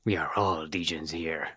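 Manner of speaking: deep conspiratorial joke voice; mock ominous. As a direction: growl it like a sinister cult line. Low mock-ominous banter.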